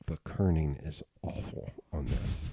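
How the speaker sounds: low, slow, soft muttered verdict; muttered judgment. Retold or imitated. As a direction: grumble it low and slow to yourself. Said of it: "I was like".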